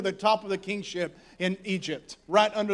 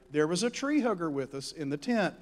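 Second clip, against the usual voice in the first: usual speaking voice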